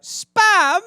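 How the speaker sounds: high, loud mock wail; retold childish dismay. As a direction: cry it out like an upset kid, hammed up. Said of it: "and I started crying".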